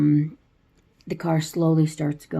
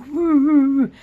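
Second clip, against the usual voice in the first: much higher, louder muffled woo through a gag; muffled gagged cries; 9 semitones higher, 6 dB louder than usual. Retold or imitated. Retelling the movie, gagged kid cries out through the gag, much higher and louder. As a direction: cry for help with a gagged mouth.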